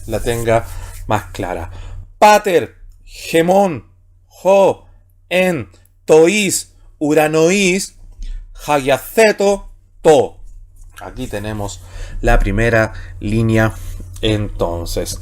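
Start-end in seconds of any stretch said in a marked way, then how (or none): 2.2-10.4 slow Greek syllable reading; reading Greek aloud. Shown as a demonstration. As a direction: read each Greek word carefully. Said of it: "Leamos la primera línea".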